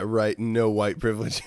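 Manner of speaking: ordinary talk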